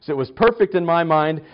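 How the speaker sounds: ordinary delivery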